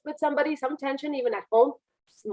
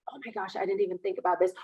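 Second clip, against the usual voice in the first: flustered candidate; 2 semitones lower, 6 dB quieter, faster than usual. Acted. Voices a flustered interview candidate.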